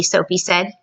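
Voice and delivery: ordinary delivery, nothing marked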